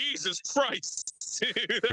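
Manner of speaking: slow, high, laughing "Jesus Christ"; laughing disbelief. Retold or imitated. Reacting to the house fire story, slow and laughing. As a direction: stretch it out in amused shock.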